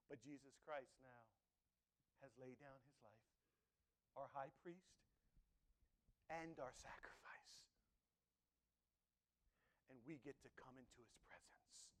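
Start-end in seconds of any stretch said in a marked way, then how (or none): 2.2-11.9 hushed and reverent; awed whisper. Real, not acted. Preacher whispering in awe to the congregation. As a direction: whisper it with wonder.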